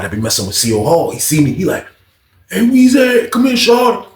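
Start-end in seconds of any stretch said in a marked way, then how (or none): 2.5-4.1 calling out. Retold or imitated. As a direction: hail him from a distance. Friend calls him over from pod.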